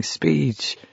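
softly imitated crowd chant; hushed mock yell. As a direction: call out like a crowd, but keep it down. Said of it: "everyone started yelling".

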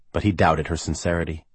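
ordinary delivery

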